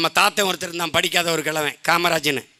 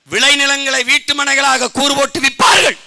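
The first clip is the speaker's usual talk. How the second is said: much higher and louder; prophetic shout; 8 semitones higher, 9 dB louder than usual. Real, not acted. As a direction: proclaim the prophecy to the crowd. Proclaiming old prophecy. (தீர்க்கதரிசன உரத்த முழக்கம்)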